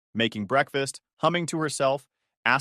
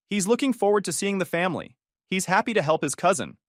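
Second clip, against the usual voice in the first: lightly voiced mom, pitched higher; mom talking up plans; 7 semitones higher than usual. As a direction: pitch it up a little, imitating mom telling relatives. Mom talking up trip to relatives.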